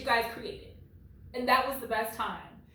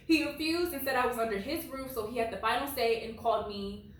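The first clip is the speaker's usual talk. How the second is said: ordinary talk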